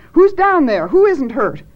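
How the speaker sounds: high, loud urgent questions; alarmed demand. Acted. Janice pokes her head into the blackness, alarmed demand. Janice calls into the dark shaft.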